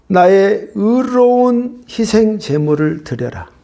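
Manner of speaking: solemn command. Acted. God's command to offer sacrifice, slow and proclaimed. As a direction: lay out each word slowly.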